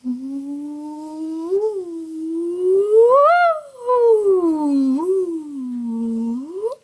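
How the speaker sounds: long wordless rising and falling glide; one swooping "ooh" that swells loud and high; tracing swoop. Shown as a demonstration. Tracing the treble clef at story's end, long vocal swoop. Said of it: "So we'll trace the treble clef".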